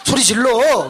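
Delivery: bewildered demand. Retold or imitated. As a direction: imitate a husband unnerved by sudden meekness.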